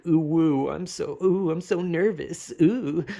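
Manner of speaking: lightly hammed-up flustered cooing, fingertips together; mock bashful crush. Crush-struck person dropping hints, lightly mocked bashful ooh-ing. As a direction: coo like a shy crush, half-mocking. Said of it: "all like".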